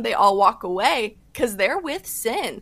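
ordinary talk, nothing marked

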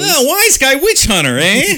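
high, loud wise-guy Stooges bark; Three Stooges voice. Retold or imitated. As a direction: yell it like a Stooges slapstick tough. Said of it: "This sounds like a Three Stooges episode".

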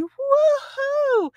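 high, loud celebratory whoop; cheering woohoo. Retold or imitated. Runner cheering herself in at the finish line, whooping. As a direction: whoop yourself across the finish. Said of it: "I just started going".

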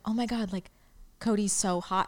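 lightly hushed, confiding retelling; retold whisper. Speaker retells confiding a crush to housemates, mock whisper. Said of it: "look over and whisper to".

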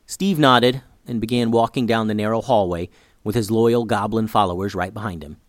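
ordinary delivery

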